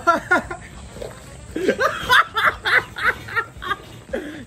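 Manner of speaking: high shrieking laugh. Real, not acted. Crude joke laughter.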